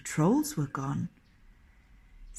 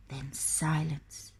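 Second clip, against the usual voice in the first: ordinary delivery, nothing marked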